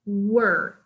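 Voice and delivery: "Were." said as wur, no diphthong; "Were." with an r-coloured vowel like fur; pronunciation of were. Shown as a demonstration. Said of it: "pronunciation of were".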